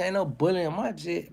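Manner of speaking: ordinary talk, nothing marked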